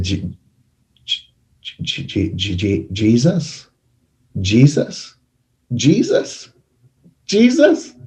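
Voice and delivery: stuttering, then a climbing cry of Jesus; desperate prayer. Retold or imitated.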